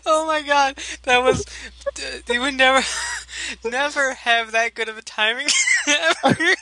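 high laughing outburst. Real, not acted. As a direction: blurt it out mid-laugh. Both cracking up at timing.

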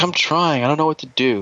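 high-pitched mock defensive whine; exasperated protest. Acted defensive reply in retelling.

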